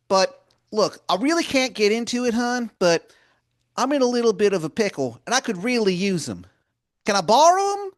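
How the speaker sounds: Baltimore wizard voice. Acted. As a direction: wheedle like a pushy old wizard begging a favor. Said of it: "he goes".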